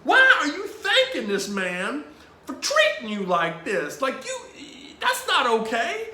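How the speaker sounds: loud, high-pitched comic outrage; worked-up, incredulous retold rant; indignant protest. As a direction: scold him in hammed-up disbelief. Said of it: "I'm kinda mad at Father".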